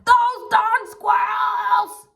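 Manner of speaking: put-on slow, high, drawn-out shout of a grumpy old man; old man yell. Old man shouting at the squirrels, slow high yell. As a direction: shake your fist and bellow it slowly. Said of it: "shook his old man fist and yelled".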